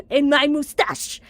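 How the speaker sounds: high loud slow gloat; hammy boast. Acted. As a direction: ham up the villain pride.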